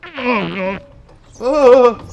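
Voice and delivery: high, loud startled cry into a laugh; startled shriek. Real, not acted. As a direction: shriek then burst out laughing. Going down the slide.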